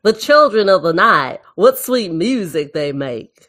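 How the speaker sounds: put-on Dracula line in a Southern accent. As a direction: attempt a hammy Southern twang. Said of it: "get my Southern accent going".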